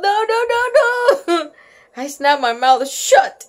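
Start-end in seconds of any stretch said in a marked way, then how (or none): 0.0-1.5 high, rapid string of protests; high-pitched storybook yell. A narrator's reading. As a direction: yelp out the refusals in alarm. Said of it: "I yelled".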